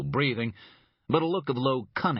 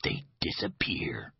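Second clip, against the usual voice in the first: slow, softer menacing whisper; 3 semitones lower, 4 dB quieter, slower than usual. Acted. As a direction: breathe the threat like a secret. He snapped his fingers.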